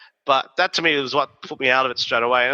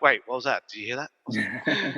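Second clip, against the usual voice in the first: slow, much softer spooked murmur; hushed nervous question; 3 semitones lower, 5 dB quieter than usual. Retold or imitated. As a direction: ask it as if something is lurking nearby. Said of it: "that moment of like".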